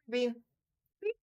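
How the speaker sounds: usual speaking voice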